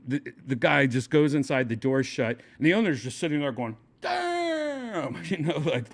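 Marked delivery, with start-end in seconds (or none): 4.0-5.2 very high, drawn-out exclamation; long high mock amazement; stunned reaction. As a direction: imitate a stunned onlooker, stretching the word.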